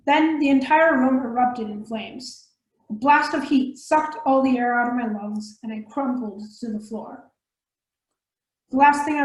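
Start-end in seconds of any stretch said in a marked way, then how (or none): none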